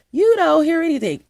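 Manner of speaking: high, loud burst of emphasis; emphatic, pitched well above normal talk. Real, not acted. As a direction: hit it high and loud, easing down at the end. Stressing total silence of equipment.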